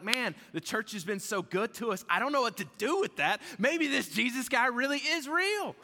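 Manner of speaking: high, loud, astonished voice; amazed admission. Acted. Voices won-over haters marvelling at church.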